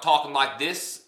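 bad Boston accent. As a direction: try a Boston accent and miss. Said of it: "that wasn't a good Boston accent".

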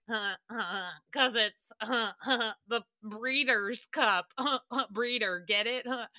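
dopey chuckling jokester, huh-huh between phrases; Jameson. Retold or imitated. Producer explaining his breeder pun to host, mock dopey chuckle. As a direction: imitate someone snickering at their own pun. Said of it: "And Jameson was like".

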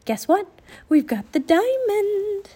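high singsong announcement, stretched at the end; excited calling out. A narrator's reading. Annie tells the invisible children, excited calling out. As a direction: call the big news out high and loud. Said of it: "she called to the invisible children".

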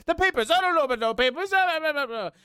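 high, fast mock film-character rant; mocking high-pitched babble; going on and on. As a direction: imitate her rattling on, high and hammy. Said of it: "she's just going on and on and on".

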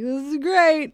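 high, loud sarcastic cheer; mock cheer. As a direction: fake delight amid disaster. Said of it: "and be like".

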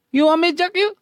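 very high put-on maid voice. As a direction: tease like a cheeky maid.

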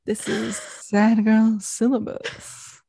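slow, sultry late night DJ; late night radio voice. Pretend. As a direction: drawl it out like a tired radio host. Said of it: "like, late night radio voice".

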